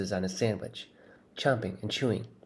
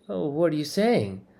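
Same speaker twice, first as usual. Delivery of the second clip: feigned innocence; 7 semitones higher than usual. Acted.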